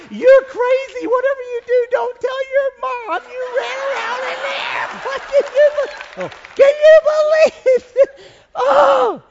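put-on very high, drawn-out falsetto baby talk; talking to puppy. Man scolds his puppy playfully, high falsetto pet talk.

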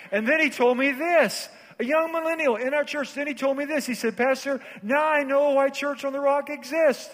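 high, ringing testimony delivery; fired-up preaching. Real, not acted. Pastor retelling a millennial's wish to serve, fired-up preaching.

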